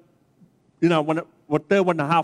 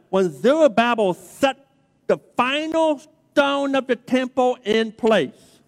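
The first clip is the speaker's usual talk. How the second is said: emphatic preaching; 3 semitones higher than usual. Acted. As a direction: preach it to the congregation, drawing out key words. Preaches and reads scripture emphatically.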